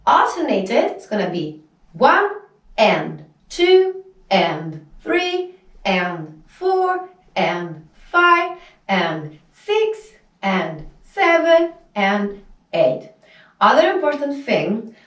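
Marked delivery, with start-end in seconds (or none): 1.9-13.1 slow, measured dance count; counting out reps. Shown as a demonstration. Teacher counting alternating hip lifts, slow steady count-out. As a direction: stretch out the beat with an "and" between numbers.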